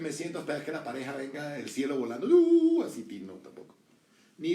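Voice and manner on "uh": high, loud whoosh sound; mock whoosh. Preacher imitating a partner flying down from heaven. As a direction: make the swoop of something flying down.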